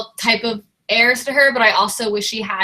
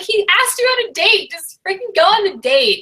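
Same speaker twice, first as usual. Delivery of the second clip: frustrated reader telling a heroine to just go on the date; exasperated; 9 semitones higher than usual. Retold or imitated. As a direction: urge her on in exasperation. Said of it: "I'm like".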